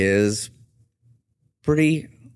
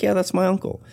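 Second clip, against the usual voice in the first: put-on high girlish voice; 12 semitones higher than usual. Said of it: "She's like".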